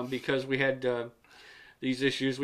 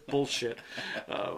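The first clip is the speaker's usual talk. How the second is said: ordinary talk, nothing marked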